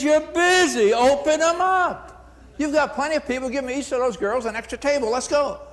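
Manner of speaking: boss giving orders. Retold or imitated. Boss tells the hostess to open them up, commanding. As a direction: order her to open the tables.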